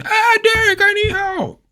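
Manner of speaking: very high falsetto cry for Derek; mock screaming. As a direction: shriek it like a damsel. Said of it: "store screaming".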